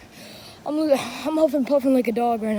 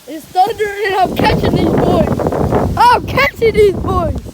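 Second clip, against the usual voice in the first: slow, louder hype; hyped declaration; 4 semitones higher, 6 dB louder, slower than usual. Real, not acted. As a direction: announce the hunt, pumped up. Hyped chase in storm.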